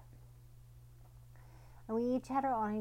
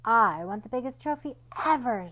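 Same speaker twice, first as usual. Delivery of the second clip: eager wish; 3 semitones higher, 10 dB louder than usual. Acted. Eager dog Cleo.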